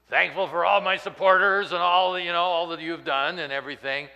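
mock victory speech.

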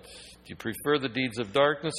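ordinary delivery

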